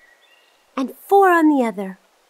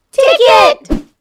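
excited shout; 9 semitones higher, 7 dB louder than usual. Acted. Children call out the answer.

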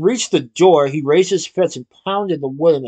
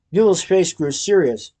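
ordinary delivery

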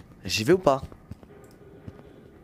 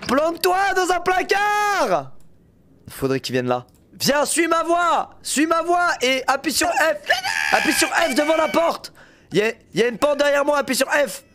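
high urgent shouting to a teammate; calling out; 11 semitones higher than usual. Real, not acted. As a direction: yell so he hears you. Calling teammate to hiding spot.

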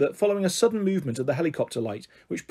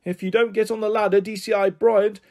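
raised, higher-pitched protest; shouting; 6 semitones higher than usual. A narrator's reading. PC Middleton warns DCI Bryant about getting into trouble, shouting. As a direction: call out the warning, high and urgent. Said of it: "shouted P C Middleton".

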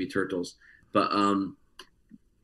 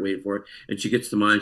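ordinary talk, nothing marked

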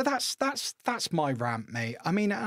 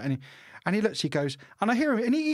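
ordinary delivery

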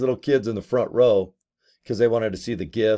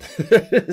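real laugh; 10 semitones higher, 5 dB louder than usual. Real, not acted. Laughing after kids bit.

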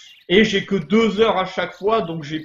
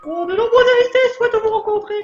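very high falsetto princess, loud; hammed-up falsetto royal invitation, rattled off; Princess Peach; 5 dB louder than usual. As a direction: squeal it like a dainty game princess. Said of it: "on va faire la voix de la princesse Peach".

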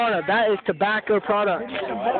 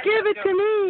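squeaky voice, way higher; high mock voice; 10 semitones higher than usual. Mocking a friend at the river in a high voice. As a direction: mimic her in a high squeaky voice. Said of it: "She's like".

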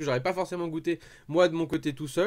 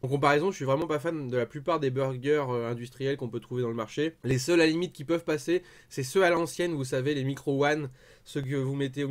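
chewing while talking; talking between bites; mouth partly full; 3 semitones lower than usual. Real, not acted. Seen 2: Bites kebab at -1s, then talks holding it; mouth likely still partly full.

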